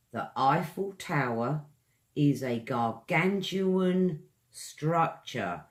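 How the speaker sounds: slow, gargantuan stressed. Shown as a demonstration. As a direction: say it again slowly for the class. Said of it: "Example sentence".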